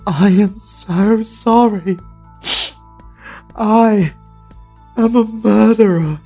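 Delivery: ordinary talk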